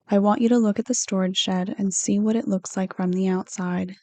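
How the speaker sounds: ordinary delivery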